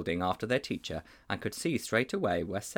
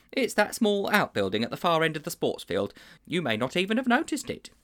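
a touch higher, earnest schoolmaster; lightly raised voice of a worried schoolmaster; lightly voiced teacher; 7 semitones higher than usual. A narrator's reading. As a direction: confide like a troubled old teacher. Teacher explains bothy.

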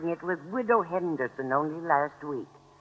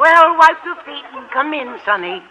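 put-on higher and louder, talking down to a little boy; mock motherly; 9 semitones higher, 6 dB louder than usual. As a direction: scold him like a child coming in from play. Landlady teases old man as kid.

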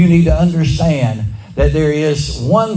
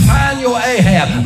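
slower, higher call: Find your Ahab; slow preached call; 5 semitones higher, slower than usual. Shown as a demonstration. Preacher drives the congregation with a slow call. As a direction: stretch each word of "Find your Ahab" out. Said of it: "Tell somebody".

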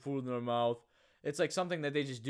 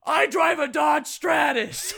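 mock yell, much higher and louder; imitating a screaming dad; 14 semitones higher, 14 dB louder, slower than usual. Retelling Will Ferrell's dinner-table blowup, mock screaming. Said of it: "And then Will Ferrell's like".

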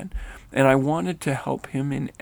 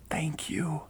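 soft whispered thanks; tearful thanks; 2 semitones higher, 7 dB quieter than usual. A narrator's reading. Nathaniel thanking Rennell, whispered through tears. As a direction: breathe it through happy tears. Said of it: "crying now with gratitude and joy".

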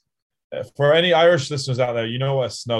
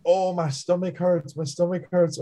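complaining friend; 7 semitones higher than usual. Retold or imitated. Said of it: "Two, two minutes in".

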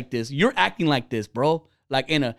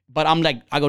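ordinary talk, nothing marked

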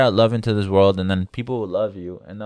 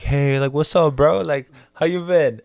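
higher-pitched, upbeat hail to a buddy; casual, pitched-up hello; friendly greeting; 7 semitones higher, 4 dB louder than usual. Retold or imitated. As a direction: greet a pal brightly, voice lifted. Acts out greeting the food truck guy.

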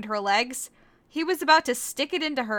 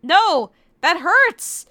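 loud high mock cry of pain; 7 semitones higher, 9 dB louder, much slower than usual. Kagome being hurt by Korra, loud mock cry. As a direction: cry out, hammed.

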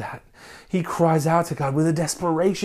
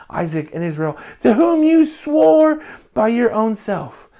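loud, pleading scripture reading, higher pitched; impassioned plea read aloud; passionately cried out; 6 semitones higher, 4 dB louder than usual. Shown as a demonstration. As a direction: cry the verses out to God with passion. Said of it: "That's a part to be cried out".

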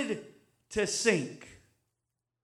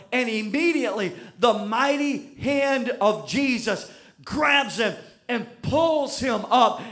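loud, driving preaching; full-force preaching; 7 dB louder than usual. Acted. Preacher pressing the point loudly on the crowd. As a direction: preach it with everything.

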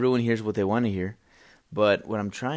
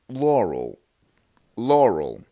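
flat, falling robotic Laurel; computer voice imitation; 4 dB louder, slower than usual. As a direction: copy a computer saying the word. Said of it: "perfect replica of it".

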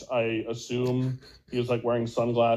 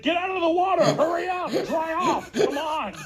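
high, louder heckling at the screen; urgent mock shouting, much higher pitch; exasperated yelling; 3 dB louder than usual. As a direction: holler impatient orders at film characters.

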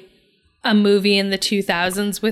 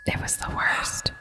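whisper; 9 dB quieter than usual. Real, not acted. Cringing at the scene.

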